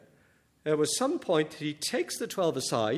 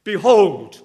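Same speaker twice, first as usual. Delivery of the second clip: Behold called out loud, falling at the end; Behold, declared to the congregation; proclaimed behold; 10 dB louder than usual. Shown as a demonstration. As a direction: ring out Behold like a summons. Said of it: "not just a little sentence".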